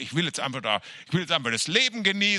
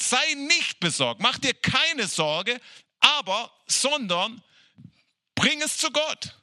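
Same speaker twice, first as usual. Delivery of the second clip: higher, slow, earnest scripture quote; Paul urging the church to pray: high, slow and earnest; raised emphatic quote; 8 semitones higher, slower than usual. Retold or imitated. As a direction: lift it up slow like Paul's urgent counsel. Quoting Paulus emphatically.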